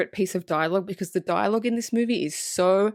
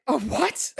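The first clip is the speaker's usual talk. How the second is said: mock shock. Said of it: "I'm like".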